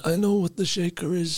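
a pompous crime boss gloating to his crew; Tooth Fairy mob boss. Acted.

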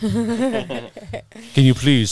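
slow, laughing request. Real, not acted. Host laughing as she calls her daughter in, laughing. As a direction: laugh through the words as you ask. Host jokes about calling Ada in.

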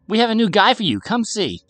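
put-on high, loud child calling excitedly; calling back. A narrator's reading. Girl inviting mother to see new guy, calling back. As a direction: holler it to someone upstairs.

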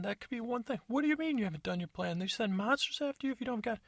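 high squeaky voices trading insults; high-pitched comic squabble; squeaky bickering voice. Acted. Arguing continues in voices.

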